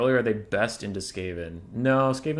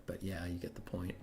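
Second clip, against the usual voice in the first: whispered aside; 4 semitones lower, 15 dB quieter than usual. Real, not acted. Aside after misspeaking.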